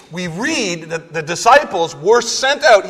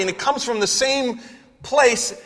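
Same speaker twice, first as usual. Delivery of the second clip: ordinary talk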